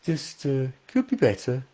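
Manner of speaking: understated, downbeat Brummie; Birmingham accent. Retold or imitated. Birmingham local grudgingly answering an interviewer, downbeat Brummie accent. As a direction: imitate a half-hearted Brummie interviewee. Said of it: "it's quite a negative sounding accent".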